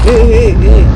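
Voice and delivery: loud, alarmed repeated cries; startled exclamations. Real, not acted. Player jolted by a jump scare, loud alarmed cries. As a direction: blurt out in alarm.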